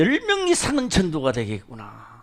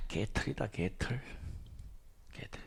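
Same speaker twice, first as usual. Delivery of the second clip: much softer, lower muttered scorn; quiet inner scorn; 10 semitones lower, 18 dB quieter than usual. Retold or imitated. The pastor's inner thought about a new convert, softly scornful. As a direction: grumble it under your breath.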